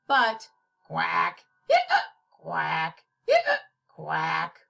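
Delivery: low quacking; lightly imitated duck quacks. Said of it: "tried quacking like a duck".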